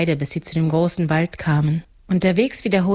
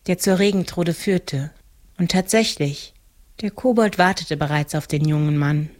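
ordinary delivery

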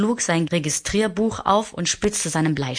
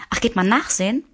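put-on a higher, curious boyish ask; eager question; 7 semitones higher, faster than usual. A narrator's reading. Little prince asks the geographer eagerly, pitched higher. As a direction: perk up with curiosity.

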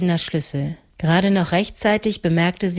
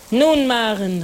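higher, ringing call of her name; 5 semitones higher, slower than usual. A narrator's reading. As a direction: hail her from a distance. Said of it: "rief er".

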